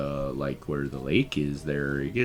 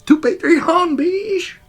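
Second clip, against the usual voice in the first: squealing shout; 11 dB louder, slower than usual. Pretend. Jokey outro shout-out.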